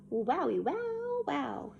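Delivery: slow, stretched-out amazement; drawn-out wonder. A narrator's reading. Reader shows the classroom picture, awed. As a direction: linger on each wow in awe.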